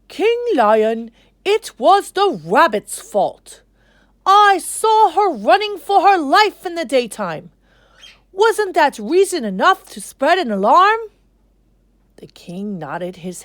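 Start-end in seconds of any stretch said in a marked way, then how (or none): 0.1-11.0 much higher, louder squawking crow; a big bird defending itself. Acted. The crow defending himself to King Lion, high and loud. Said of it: "He said".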